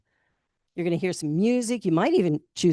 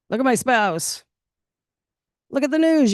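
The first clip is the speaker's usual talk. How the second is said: higher and louder climax; building to a peak; 7 semitones higher, 4 dB louder than usual. Acted. Preacher listing life's mess, rising to a loud peak. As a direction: top the list with a louder call.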